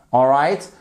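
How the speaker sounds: normal talking voice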